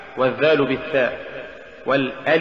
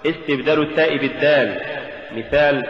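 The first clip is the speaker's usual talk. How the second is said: normal talking voice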